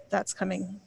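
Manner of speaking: ordinary delivery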